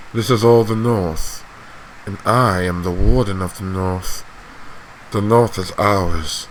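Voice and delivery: measured, controlled authority; cold commanding patriarch. Acted. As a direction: give orders softly, expecting obedience. Acting a commanding lord.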